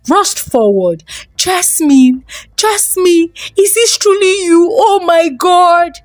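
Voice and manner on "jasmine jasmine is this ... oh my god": high, crying voice breaking with disbelief; a father sobbing as he sees his lost daughter alive; tearful father. A narrator's reading. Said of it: "Tears poured down his face".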